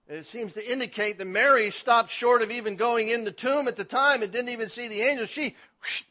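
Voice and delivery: loud, driving pulpit voice; full-force preaching. Acted. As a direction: preach it out to the back pews.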